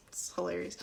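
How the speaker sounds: whispered through a laugh. Real, not acted. Reader giggles at the flapping ears, whispering. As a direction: giggle the words out softly.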